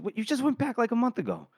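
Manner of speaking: high-pitched puzzled retort; confused disbelief. Retold or imitated. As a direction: act out baffled surprise, pitched right up. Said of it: "Crawl's confused".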